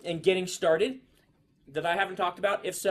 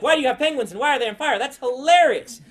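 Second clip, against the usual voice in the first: higher and much louder, a mock incredulous kid cracking up; imitating laughing kids; 9 semitones higher, 11 dB louder than usual. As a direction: rattle off the question fast, then stretch out the verdict. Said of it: "They're like".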